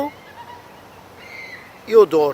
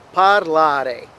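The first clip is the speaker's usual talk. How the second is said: "Parlare" with the weight on the middle syllable, LA; stress on middle syllable. Shown as a demonstration. Teacher showing learners where emphasis falls, stressing LA in "Parlare". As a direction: lean on the LA in "Parlare", drawn out. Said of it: "emphasis will generally fall in the middle".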